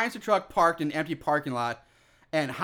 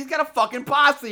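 loud; 6 semitones higher, 4 dB louder than usual. Real, not acted. Shouting emphasis.

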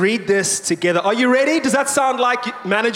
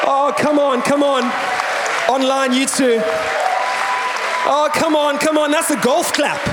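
hype shout; 7 semitones higher than usual. Real, not acted. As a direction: whip the room into praise. Pastor hyping congregation to praise.

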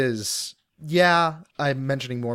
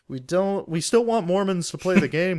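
mock marketing exec; 5 semitones higher than usual.

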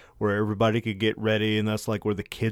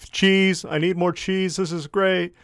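cheesy tourist gushing; 7 semitones higher than usual. Retold or imitated. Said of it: "They were just like".

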